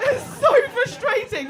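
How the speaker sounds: high, loud and slow; frustrated shriek. Real, not acted. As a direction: wail it in mock outrage. Ranting, voice up high.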